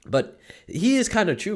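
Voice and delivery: normal talking voice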